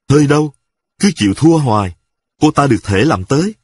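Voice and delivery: usual speaking voice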